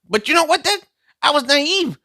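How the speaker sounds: mock earnest confession. Confessing to the audience.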